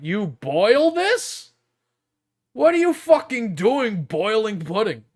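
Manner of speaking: high, loud incredulous outburst; appalled disbelief. Real, not acted. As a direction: question it like you can't believe it. Baffled reaction to boiled pudding.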